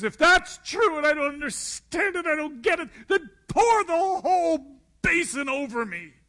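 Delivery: high, loud, laughing demand; exuberant outburst. Acted. Peter begging Jesus to wash all of him, a high laughing outburst. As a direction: blurt it out with eager overkill.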